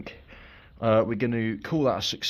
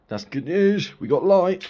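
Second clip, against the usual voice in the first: excited; 8 semitones higher, 7 dB louder than usual. Real, not acted. Battery hooked, light works.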